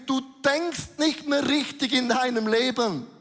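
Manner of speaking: laute, höhere Predigerstimme, eindringlich; full-force preaching. Acted. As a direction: der Gemeinde das Wort einhämmern. Predigt mit voller Kraft. (Prediger ruft der Gemeinde eindringlich zu)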